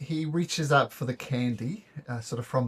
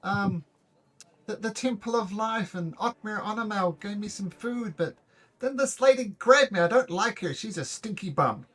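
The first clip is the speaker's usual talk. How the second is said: put-on mildly tearful kidnapped child; a hint of a sniffly kid telling it; sobbing kid; 6 semitones higher than usual. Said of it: "sobbing a little bit".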